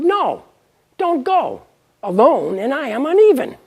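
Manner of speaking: high pleading voice; high pleading wife. Acted. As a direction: beg him not to go, high and loud. Even warns Adman not to go.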